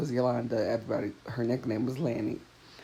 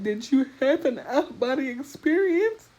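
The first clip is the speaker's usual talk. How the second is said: high-pitched mock sobbing question; imitated tearful doctor; 13 semitones higher, 4 dB louder than usual. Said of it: "he starts crying, like, sobbing".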